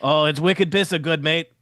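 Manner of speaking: a bad, lightly done Boston accent; hammy Boston accent, barely there. GM answers players in a shaky Boston accent. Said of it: "my Boston accent is not good".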